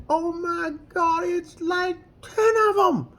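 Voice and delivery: high, exasperated Oh my God; shocked disbelief. Retold or imitated. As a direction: retell your own shocked reaction. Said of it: "And I'm like".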